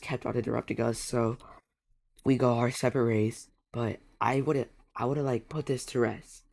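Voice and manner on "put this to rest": ordinary talk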